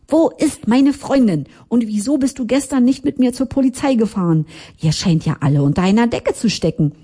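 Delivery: high-pitched, indignant questioning without real volume; mildly yelled reproach; understated angry shouting. A narrator's reading. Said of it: "schrie ich ihn sofort an".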